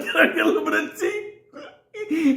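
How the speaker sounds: high, small, sheepish ask, laughing; meek mock request. Thirsty prisoner caving to inmates after hours, high sheepish ask. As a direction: beg sweetly like a beaten man. Thirsty friend gives in meekly.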